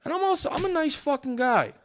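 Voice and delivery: high-pitched offended claim; indignant. Real, not acted. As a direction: squeak out the indignation. Ranting about being called nasty.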